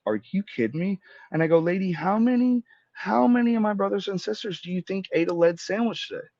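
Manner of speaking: high-pitched, distraught retelling; mildly hysterical challenge, retold; retold hysterics. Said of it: "now I'm hysterical".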